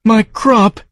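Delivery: high creature moan. Acted. The farm moaning over its crop to Joe, high mournful creature. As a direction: moan it out in loss. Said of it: "it moaned quietly".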